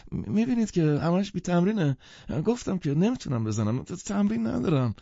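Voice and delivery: put-on high stammering apologetic young man; nervous pianist. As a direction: stutter the apology, high and flustered.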